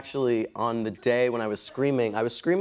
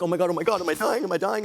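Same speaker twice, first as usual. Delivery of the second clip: mock panic; 7 semitones higher, faster than usual. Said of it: "called a doctor freaking out".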